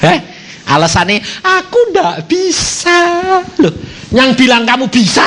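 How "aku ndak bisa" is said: very slow, high mock whine; whining excuse. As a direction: drag out the excuse. Excuse imitated again. (keluhan merengek sangat lambat)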